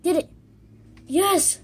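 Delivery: high, loud burst of relief; triumphant cheer. Real, not acted. Gamer cheering at last getting the wood in. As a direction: shout it like you finally won. Finally succeeds after long struggle.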